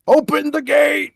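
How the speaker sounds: high, booming villain command; Bowser impression. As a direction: imitate a commanding cartoon villain. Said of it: "you hear him like".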